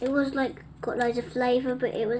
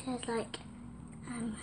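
hoarse, breathy and slow; words barely voiced from a sore throat; 2 semitones lower, 16 dB quieter, slower than usual. Real, not acted. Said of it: "sometimes I'm losing my voice".

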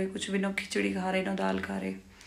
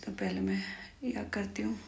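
whisper; 9 dB quieter than usual. Real, not acted. Night, patient sleeping nearby.

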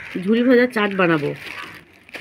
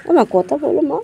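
calling the pet; 3 semitones higher than usual. Real, not acted. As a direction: coax the animal to answer.

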